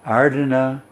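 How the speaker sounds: ordinary talk, nothing marked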